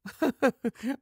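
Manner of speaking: short high chuckle; light acted laugh; laughter. A narrator's reading. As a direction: break into an amused chuckle. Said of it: "і розсміявся".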